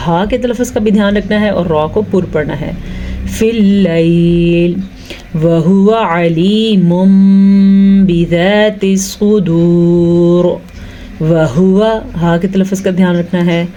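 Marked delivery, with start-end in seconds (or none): 3.3-13.7 slow, measured tajweed chant; Quranic recitation. Shown as a demonstration. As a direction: recite each phrase slowly and evenly. Recites verse with comments.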